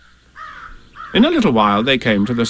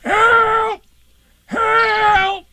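very high drawn-out cry; shrieked, long distress call; calling for help. Acted. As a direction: wail for rescue from up a tree. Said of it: "called out to them".

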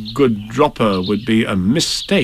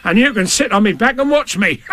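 higher, cocky character voice; blustering bravado, pitched up; brave Tigger; 11 semitones higher than usual. Acted. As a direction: show off bravely. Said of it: "said Tigger bravely".

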